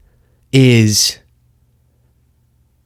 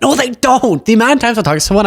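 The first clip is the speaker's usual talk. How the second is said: exasperated rebuttal; 11 semitones higher than usual. Real, not acted. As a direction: pitch leaps way up in protest. Rebutting Rogan's claim in exasperation.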